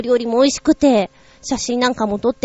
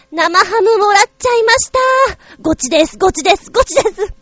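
high, loud delighted squeals; excited; 8 semitones higher, 5 dB louder than usual. Real, not acted. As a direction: gush with real glee. Thrilled over a gift of ham.

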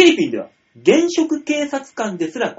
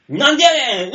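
high, punchy Kansai-dialect retort; mock a comedian's comeback in Kansai-ben; Kansai tsukkomi; 5 semitones higher than usual. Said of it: "ツッコミを聞いたら".

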